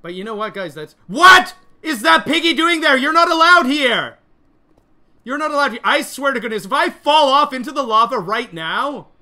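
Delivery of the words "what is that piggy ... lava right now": high, loud, indignant shouting; outraged yelling. Real, not acted. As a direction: yell at an intruder who shouldn't be there. Outraged at piggy near lava.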